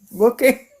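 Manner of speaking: high, rising reaction; bemused surprise. Retold or imitated. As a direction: retell it as a high, puzzled okay. Retells baffled reaction to the noise.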